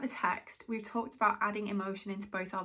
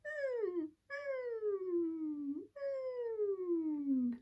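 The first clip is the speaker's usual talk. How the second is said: high falling "mm" whine; sad puppy whimper; 10 semitones higher, much slower than usual. Shown as a demonstration. Singing teacher showing students the twang tilt, sad puppy whimper. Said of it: "as if you're a sad pup".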